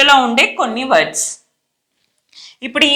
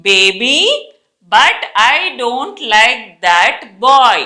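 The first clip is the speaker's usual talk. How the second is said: very slow, word-by-word reading with pauses; halting teacher dictation, every word separate; read-along model; much slower than usual. Shown as a demonstration. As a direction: read it out slowly for learners to follow. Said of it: "ఒక sentence నేను చదివి వినిపిస్తాను".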